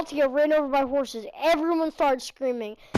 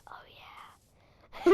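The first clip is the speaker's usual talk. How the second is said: soft whispered agreement; hushed, voiceless reply; 8 dB quieter than usual. Retold or imitated. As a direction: whisper back to a friend. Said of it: "we like to whisper a lot".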